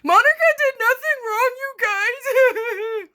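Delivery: loud, high sarcastic echo; mocking singsong. Real, not acted. Vlogger repeating the lawyer's quote to viewers, loud and mocking. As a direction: throw the line back with glee.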